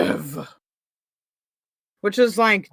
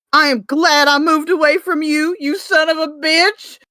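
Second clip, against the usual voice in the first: higher, mock-furious heroine; Tia's voice; 6 semitones higher than usual. Reading Tia insulting Paul, hammed-up mild anger. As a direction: ham up her parting insult a little. Reads Tia's dialogue aloud.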